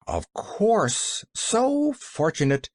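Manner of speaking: slow, high-pitched sarcastic agreement; sniffy. A narrator's reading. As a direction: stretch it out with lofty disdain. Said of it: "sniffed the man with uplifted eyebrows".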